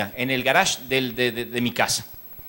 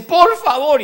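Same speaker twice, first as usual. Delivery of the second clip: high, louder pleading; exasperated plea; 11 semitones higher, 6 dB louder, much slower than usual. Retold or imitated. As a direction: beg them to stop the noise. (vecino harto, súplica aguda y fuerte)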